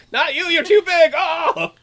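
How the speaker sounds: high, loud comic panic; mock alarm. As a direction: cry out in hammed-up alarm. Mock train conductor refusing a passenger.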